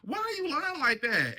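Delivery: high, loud mock accusation; mock outrage. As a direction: call her out, high and loud, in mock disbelief. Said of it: "This is the moment where I'm like".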